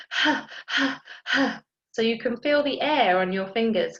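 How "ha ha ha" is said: out-of-breath panting. Shown as a demonstration. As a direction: pant like a tired runner. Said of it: "you're going".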